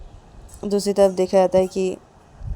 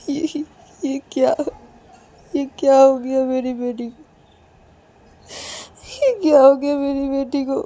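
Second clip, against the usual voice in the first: tearful wail, voice lifted high above narration; acted loud weeping; 7 semitones higher, 4 dB louder than usual. Mother reacting to her daughter's condition, acted sobbing. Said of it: "पायल की mummy बहुत ज़ोर ज़ोर से रोने लगती है".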